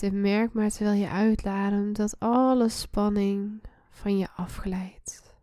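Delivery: usual speaking voice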